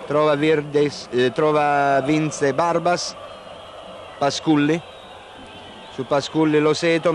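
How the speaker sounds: ordinary talk, nothing marked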